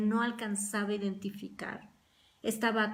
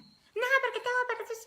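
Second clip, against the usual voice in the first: much higher, squeaky mocking complaint; mock snappy retort. Preacher mimics a rude spouse's retort, high mocking squeak. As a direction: parody someone answering back rudely.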